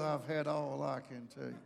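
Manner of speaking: very low, hushed, tightly controlled threat; fed-up inflection. Shown as a demonstration. Preacher showing how inflection changes words, low and fed up. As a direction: say it low through the teeth, barely holding back. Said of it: "the inflection of your voice".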